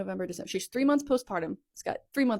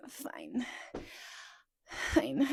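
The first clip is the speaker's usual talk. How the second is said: reluctant pushover; 4 dB quieter than usual. Retold or imitated. Pushover agreeing to meet her ex, reluctantly giving in. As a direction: give in reluctantly, as if retelling it. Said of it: "was a big pushover".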